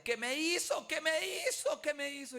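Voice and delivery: high, loud, desperate cry of what did you do to me; woman yelling at the guard, beside herself; frantic shouting. Retold or imitated. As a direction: shriek it over and over like she's losing her mind. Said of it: "Y me gritaba a mí".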